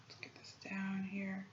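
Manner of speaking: soft unvoiced mutter to self; whispering. Real, not acted. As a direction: whisper quietly while working. Muttering to self while drawing.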